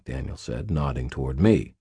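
ordinary delivery

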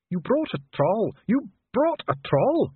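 slow, high-pitched dwarf outrage; appalled Dee. A narrator's reading. Dwarf appalled at the envoy's troll, incredulous. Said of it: "muttered Dee".

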